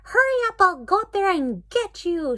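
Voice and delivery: high, loud witch shriek. Acted. The witch shrieking up at Dory, high and loud. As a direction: shriek up the stairs like a furious witch. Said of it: "shrieked the Big Witch".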